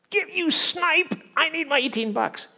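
shrill mock demand. Preacher voicing the greedy servant, high outraged yell. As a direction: yell it like a petty creditor.

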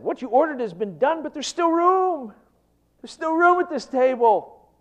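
high, loud, urgent announcing; bursting to tell the master there is room; servant's eager report. Acted. Servant reporting to his master, loud and eager.

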